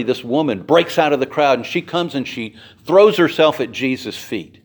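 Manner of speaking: ordinary delivery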